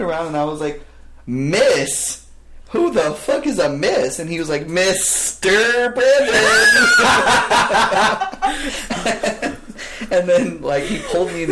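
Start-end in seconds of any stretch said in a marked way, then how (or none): 1.3-4.2 louder, higher outraged retort; mock indignant. Turning on the boss who called him miss, loud and outraged. 4.7-6.3 slow, loud and high; boss overcorrecting. Retold or imitated. Imitating the boss correcting himself, slow and loud. 6.4-10.0 high burst of laughter; loud laugh. Real, not acted. To his friends after the boss story, laughing loudly.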